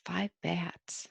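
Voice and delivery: soft whispered count. Acted. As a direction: breathe the words with no voice.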